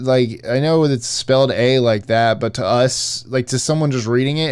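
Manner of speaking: ordinary talk, nothing marked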